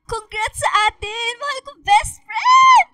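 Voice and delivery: high, loud, slow squeal of joy; best friend yelling congratulations at graduation; excited shout. Acted.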